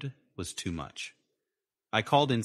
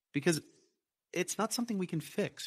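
snapped; 11 semitones higher than usual. A narrator's reading. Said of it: "she snapped".

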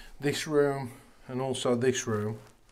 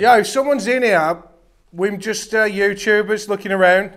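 loud, raised-pitch call to someone unseen; 7 semitones higher, 9 dB louder than usual. Real, not acted. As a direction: announce yourself across an empty building. Calling out to a possible intruder.

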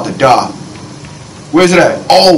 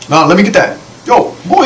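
normal talking voice

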